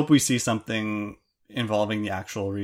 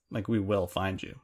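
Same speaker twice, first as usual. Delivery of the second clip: mock creepy threat; 3 semitones lower, 6 dB quieter than usual. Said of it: "on that creepy note".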